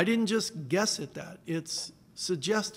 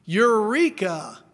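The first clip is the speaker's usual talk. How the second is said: a ringing Eureka with a falling end; mock exclamation; 4 semitones higher, 7 dB louder than usual. Preacher quoting Archimedes' famous cry, mildly excited. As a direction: call out Eureka like a discovery. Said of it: "he said his famous line".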